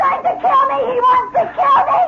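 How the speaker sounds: high, loud, fast shout of terror; terrified scream. Acted. Terrified girl screams that the man wants to kill her. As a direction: scream that he'll kill you.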